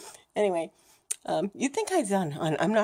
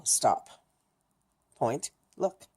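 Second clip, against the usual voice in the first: crosswalk signal voice; 4 semitones lower, slower than usual. Retold or imitated. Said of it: "it's a thing from back home, from the crosswalk".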